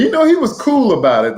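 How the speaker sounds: ordinary delivery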